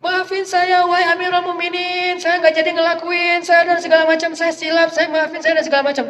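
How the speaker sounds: a lightly acted tearful apology; mock crying plea. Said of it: "Langsung dia nangis".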